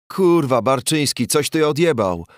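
high, loud outraged yell; furious shout. Acted. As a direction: explode at what he sees. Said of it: "krzyczał bandura".

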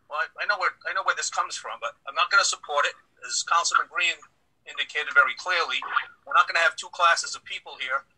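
ordinary talk

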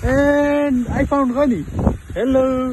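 high, loud, drawn-out hello; excited greeting. Real, not acted. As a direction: call out to the monkey with delight. Greeting a lonely monkey at zoo.

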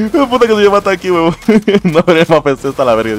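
high-pitched griping through laughter; laughing mock complaint. Streamer repeating a pal's map complaint, laughing mock rant.

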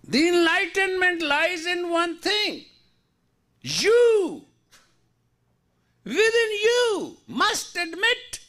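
high-pitched, driving sermon; impassioned preaching. Real, not acted. Preaches at full force.

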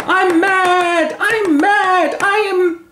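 very high, loud shriek; hammed-up hysterical wailing; mad Ophelia. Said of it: "Ophelia storms in".

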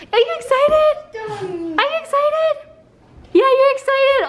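very high-pitched pet voice. Real, not acted. Owner asks dog if he is excited, gushing.

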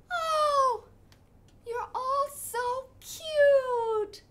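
high, slow, loud coo of a delighted mother; doting mama duck. Acted. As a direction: gush over the babies. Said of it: "Mama duck looks ... and go".

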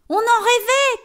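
high, loud joyful cry; shouting children. Acted. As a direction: shout it like excited kids. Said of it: "crient les enfants". (cri joyeux d'enfants)

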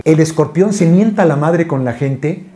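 usual speaking voice

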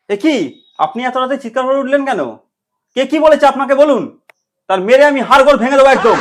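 very loud, indignant threat; blustering shout. Acted. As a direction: roar like a protector ready for a fight. Blustering threat to beat culprit. (চেঁচিয়ে হম্বিতম্বি)

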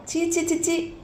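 high, breathy cries of disgust. Acted. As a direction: recoil in revulsion. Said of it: "అసహించుకున్నాడు". (అసహ్యంతో చీ చీ)

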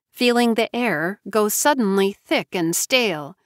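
ordinary delivery

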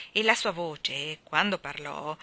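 ordinary delivery

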